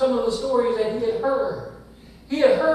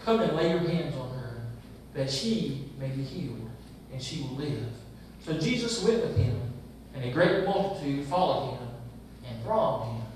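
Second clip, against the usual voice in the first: low, measured Bible reading; scripture reading; 6 semitones lower, 3 dB quieter than usual. Acted. Pastor reading the Gospel aloud to the congregation, measured. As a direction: read the verses steadily before preaching.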